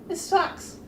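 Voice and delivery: high, small kid voice, imitated; little kids. Two little kids reject the airport movie, high imitated kid voice.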